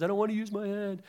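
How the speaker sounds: high, panicky Rex refusing; whiny Rex. Retold or imitated. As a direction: squeal it like a scared toy dinosaur. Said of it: "and Rex says".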